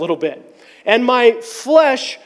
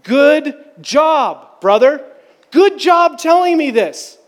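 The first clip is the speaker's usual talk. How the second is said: higher, emphatic congratulation; proud praise; 5 semitones higher, slower than usual. Retold or imitated. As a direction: cheer on a brother who just confessed. Acting out friend's praise after apology.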